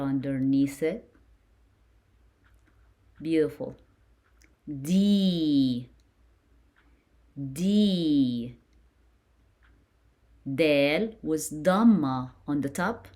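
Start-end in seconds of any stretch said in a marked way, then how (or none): 4.7-8.6 "D" as a drawn-out dee; "D" stretched out like a letter drill; long E syllable. Shown as a demonstration. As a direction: hold the ee in "D", pitch arching then falling. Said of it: "we add E".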